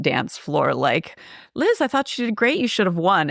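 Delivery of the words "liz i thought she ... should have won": high, quick gushing quote; gushing retold praise. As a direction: imitate an eager fan, pitched right up. Quoting a cheerful onlooker to Liz.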